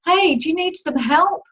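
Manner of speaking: high mock hail across water; fisherman shouting. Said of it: "the fisherman shouted".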